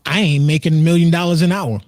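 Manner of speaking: ordinary talk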